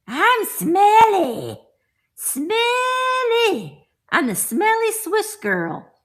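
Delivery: high, slow, hammed-up girl voice; high sing-song doll talking about herself; silly doll voice. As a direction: play the stinky doll speaking for herself. Voicing the doll for sale.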